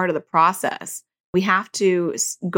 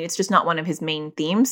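normal talking voice

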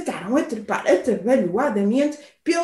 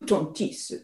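dismissive owl; 5 semitones lower, 5 dB quieter, slower than usual. Acted. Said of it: "trouxou a coruja".

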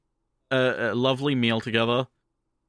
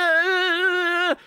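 a sudden high yelp of fright; startled scream; 4 dB louder than usual. Retold or imitated. Daughter startled when a stranger points at her, high frightened yelp. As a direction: squeal in alarm, high and short.

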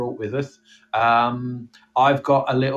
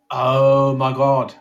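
mock dread; 5 dB louder, much slower than usual. Slow mock fright.

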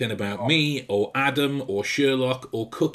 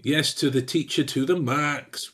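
normal talking voice

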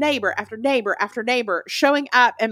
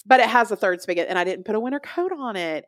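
ordinary delivery

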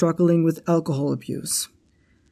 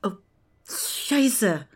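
startled curse; 3 semitones higher than usual. Acted. Startled by someone sneaking up.

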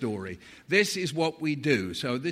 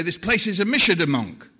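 mum bursting in; 4 semitones higher, 7 dB louder than usual. Retold or imitated. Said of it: "she would burst into our bedroom and say".

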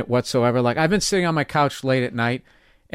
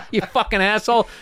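imagined comeback; 5 semitones higher than usual. Retold or imitated. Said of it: "I immediately wanna be like".

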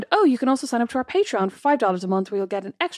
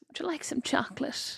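breathy unvoiced whisper, much softer; creepy whisper; 4 semitones higher, 11 dB quieter than usual. Sister mocks the creepy chocolate offer in a whisper. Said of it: "not with that voice".

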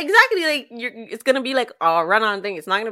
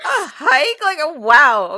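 high, louder incredulous question; mock disbelief; 6 semitones higher, 8 dB louder, slower than usual. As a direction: replay your shock, half laughing. Re-enacting disbelief at a hike.

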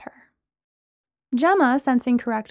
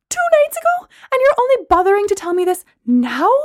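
much higher and louder outraged cry; betrayed friend shouting at Brooklyn; indignant shout; 10 semitones higher, 7 dB louder than usual. Acted. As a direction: shriek it in disbelief. Gemma furious at friend.

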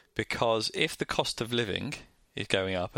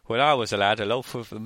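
loud put-on northern grandparent; northern accent; 5 dB louder than usual. Grandparent recalling bread prices to grandchild, mock northern accent. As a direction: imitate a northern grandparent reminiscing. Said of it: "I've still got this northern accent".

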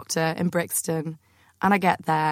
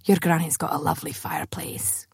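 imitated confidential whisper, softer; hushed whisper; 4 dB quieter than usual. Gynecologist leaning in to the patient, whispering. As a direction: breathe it like a secret shared in the exam room. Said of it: "he said".

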